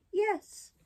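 high little puppet voice; puppet promise. Acted. Puppet promising the fairy to drink, high-voiced.

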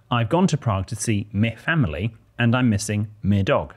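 usual speaking voice

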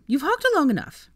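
loud, high-pitched impatience; snappish. A narrator's reading. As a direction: snap that time is up. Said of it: "snapped Ginny".

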